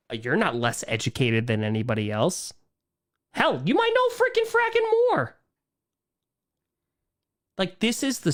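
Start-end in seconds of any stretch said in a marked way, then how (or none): 3.3-5.4 heated squeak. Real, not acted. Heated point about education.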